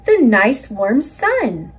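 ordinary delivery

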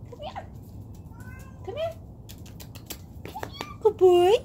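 extremely high pet-talk voice. Real, not acted. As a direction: squeal sweet nothings at the dog, sky high. Gushing baby talk to dog.

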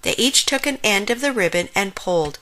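ordinary delivery

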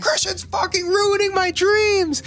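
hammed-up crying fury; mock wailing rant. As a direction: wail it from the corner.

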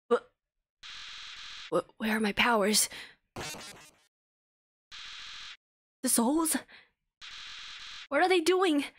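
lightly played villain breaking into panic; panicking villain. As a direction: lose control, voice climbing in panic. Flowey panics as powers vanish.

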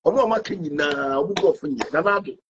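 ordinary talk, nothing marked

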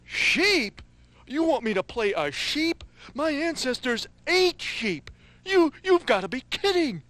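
huffy, offended puppet voice; indignant puppet. Acted. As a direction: protest like an insulted puppet. Puppet offended by sheep role.

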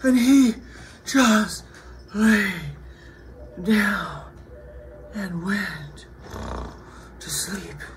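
weary storytelling that slowly dies away; fading with tiredness. A narrator's reading. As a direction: wind down into sleep, pausing between words. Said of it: "he was too tired to talk".

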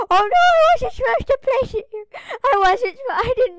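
panicked gasp. Real, not acted. Player placing a block wrongly, crying out in high panic. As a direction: gasp and cry out in dismay.

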